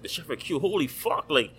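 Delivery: mock awestruck fan voice; excited fan. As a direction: play the amazed chef who can't believe it. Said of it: "the guy was like".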